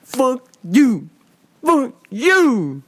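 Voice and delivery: mock defiant son, stretched separated words; hammed-up insult with a swooping second word; imitating deaf son. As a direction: play it slow and emphatic, word by word. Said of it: "And the son's like".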